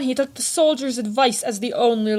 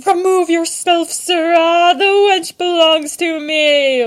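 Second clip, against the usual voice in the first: loud, higher slurred challenge, slowing at the end; drunken blabbering; 6 semitones higher, 6 dB louder than usual. A narrator's reading. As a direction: bawl it like a drunk picking a fight. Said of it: "blabbered a drunken soldier".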